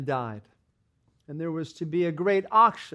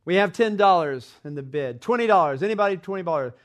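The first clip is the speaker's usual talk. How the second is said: auctioneer calling out; 3 semitones higher, 7 dB louder, faster than usual. A narrator's reading. Auctioneer calls out loudly to the crowd for twenty dollars. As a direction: sell it to the room, calling out.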